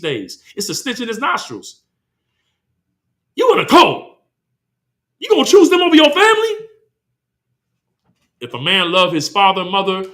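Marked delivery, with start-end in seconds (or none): 3.3-6.7 higher, outraged family member accusing; indignant parent. Retold or imitated. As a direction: play the shocked relative, pitch jumping up. Family voiced scolding the convert.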